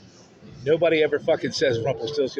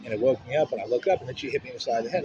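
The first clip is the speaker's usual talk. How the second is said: normal talking voice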